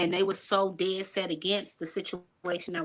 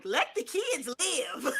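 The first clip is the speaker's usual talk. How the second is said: laughing quote; 6 semitones higher, 4 dB quieter, slower than usual. Retold or imitated. Wife's laid-back advice, laughed.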